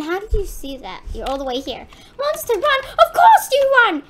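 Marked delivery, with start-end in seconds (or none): 2.2-4.0 loud, high alarm call; excited high-pitched panic; panicked warning. Real, not acted. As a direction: shout out as the monster comes. Monster appears, players flee.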